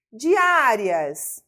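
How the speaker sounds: "Diárias" with a long, loud Á; stressed syllable demonstration. Shown as a demonstration. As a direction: punch and hold the Á in "Diárias". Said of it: "nós temos uma paroxítona".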